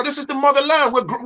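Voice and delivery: normal talking voice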